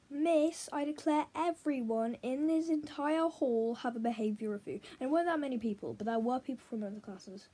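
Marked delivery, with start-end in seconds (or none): 0.1-4.8 raised, louder mock proclamation; retold yelling. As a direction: re-enact yelling a declaration to the whole hall. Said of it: "I ended up yelling".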